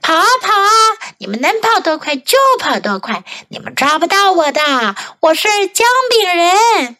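high, playful chant-like taunt; taunting gingerbread man. A narrator's reading. Gingerbread man taunts the chasing old couple, high-pitched. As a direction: tease the chasers in a high voice. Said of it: "他大笑着说".

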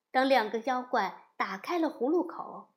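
ordinary delivery

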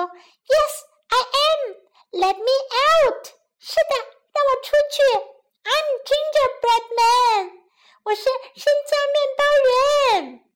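high, eager little creature; gingerbread man voice. A narrator's reading. Gingerbread man asks to be let out, high creature voice. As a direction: pipe up high and proudly. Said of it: "生姜面包人说".

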